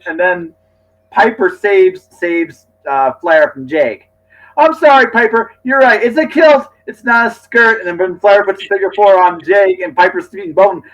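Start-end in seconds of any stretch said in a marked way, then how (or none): 4.6-7.8 much higher, louder mock-contrite commentator; hammed-up Heenan apology. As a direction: grovel theatrically to Piper.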